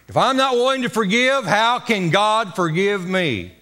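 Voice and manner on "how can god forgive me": ordinary talk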